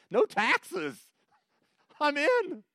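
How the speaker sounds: excited, high-pitched jump at the offer; eager volunteer. Acted. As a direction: blurt it out high and loud, instantly sold.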